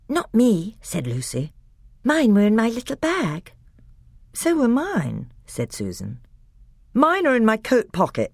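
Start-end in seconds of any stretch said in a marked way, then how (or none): 0.1-5.3 put-on child voice. 6.9-8.3 put-on boy's voice. Peter's voice.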